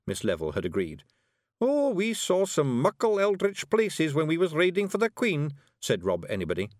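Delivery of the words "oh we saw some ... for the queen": high Scots voice telling a tall tale; boastful Scottish Feegle. A narrator's reading. Rob telling Miss Level of raiding adventures, boastful. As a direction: brag away, pitched well up, in Scots.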